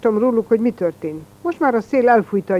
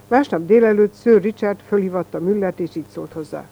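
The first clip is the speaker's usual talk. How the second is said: ordinary delivery, nothing marked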